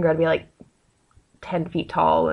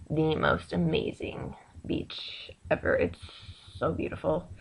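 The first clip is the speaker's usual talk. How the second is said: soft, slow whispered awe; whispered wonder at the beach; awed whisper; 3 semitones lower, 11 dB quieter, slower than usual. Real, not acted. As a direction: hush it like something precious. Describing beloved beach.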